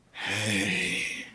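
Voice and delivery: very low, long drawn-out hello; suspicious greeting. Retold or imitated. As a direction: greet the stranger with slow suspicion. Regulars eye the stranger.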